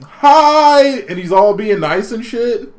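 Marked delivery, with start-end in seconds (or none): 0.0-1.0 much higher, louder bright greeting; cheery kid. Retold or imitated. A kid greeting the shopkeeper, much higher and louder. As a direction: pop in with a squeaky hello. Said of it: "he's all like".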